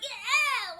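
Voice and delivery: goofy high, loud cheer; playful mock excitement; goofball. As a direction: imitate a carefree goofball whooping. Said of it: "he's sort of this goofball".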